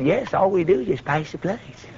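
softly voiced timid man; a hint of a meek little voice; mousy voice. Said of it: "kind of a little mousy voice".

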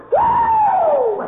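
very high loud whoop; joyous whoop. Real, not acted. Preacher jumps from the car with a high loud whoop. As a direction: whoop for joy.

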